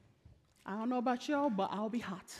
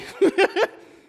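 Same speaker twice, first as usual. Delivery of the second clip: quick high laugh; short laugh; 12 semitones higher, 14 dB louder than usual. Real, not acted. Preacher laughing at the sailors' reaction, short laugh. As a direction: laugh breaks the sentence.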